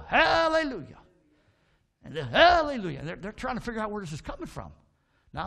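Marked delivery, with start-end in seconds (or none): 0.1-1.0 high, loud bird squawk; talking bird. Acted. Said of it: "He'll go". 2.2-3.0 high, loud bird echo; talking bird. Acted. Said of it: "He'll go".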